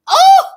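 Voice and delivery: a piercing high yelp; high shouted cry. Real, not acted. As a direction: let out a sudden shrill cry. Outburst after building repetition.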